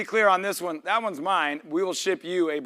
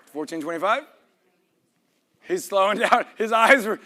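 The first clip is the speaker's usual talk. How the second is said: ordinary delivery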